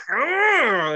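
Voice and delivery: loud, drawn-out gross "aah"; imitated squish noise. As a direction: act out a nasty squish with a high, loud noise.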